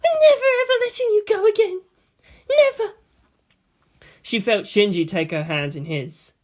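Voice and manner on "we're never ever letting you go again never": much higher voice through tears, laughing at the end; cling to her with a crying promise; tearful promise. A narrator's reading. Maya embracing in tears.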